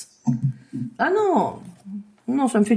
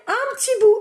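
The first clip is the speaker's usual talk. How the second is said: "petit bout" lifted high and small; high-pitched caution; 9 semitones higher than usual. Acted. First take of dosage instruction.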